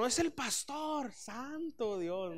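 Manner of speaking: high, drawn-out gasp of dread; slow, high alarm that the pastor is at the door; panicked brother. Retold or imitated. Brother warning family the pastor has arrived, panicked. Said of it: "dice mi hermano".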